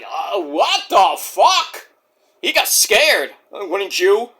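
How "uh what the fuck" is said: very slow, drawn-out disbelief; stunned Orange Cassidy. Retold or imitated. Host imitating wrestler facing Gozer, drawn-out stunned reaction. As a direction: stretch each word out in mock shock. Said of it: "Orange Cassidy's like".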